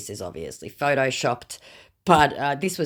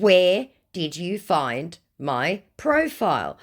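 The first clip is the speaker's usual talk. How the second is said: slow, word by word, higher pitch; pointed repetition; 5 semitones higher, 3 dB louder, slower than usual. Retold or imitated. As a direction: spell out the question for someone dim. Repeats the ignored question pointedly.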